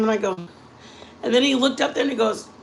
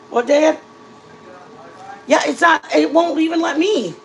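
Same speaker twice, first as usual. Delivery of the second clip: calling out; 7 semitones higher, 4 dB louder than usual. Real, not acted. Calling to family offscreen.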